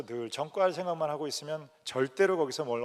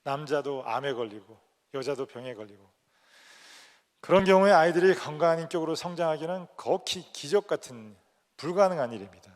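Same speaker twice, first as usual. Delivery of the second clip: ordinary talk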